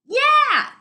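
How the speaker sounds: very high, loud yell back; shouted reply. Acted. Dune shouting back to Arlen over the river. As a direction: call it back over the roar. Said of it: "Dune shouted back".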